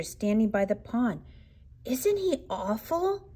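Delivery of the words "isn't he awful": put-on high slow fearful child; trembling little cub; scared child. A narrator's reading. Frightened cub pointing.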